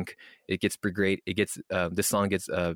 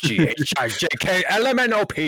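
mock alphabet recital; 6 semitones higher, 5 dB louder than usual.

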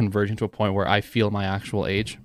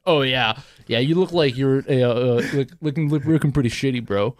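mocking impression; 3 semitones higher, 3 dB louder than usual. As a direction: tease your friend by imitating him, hammed up a little. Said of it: "if you're gonna do my impressions".

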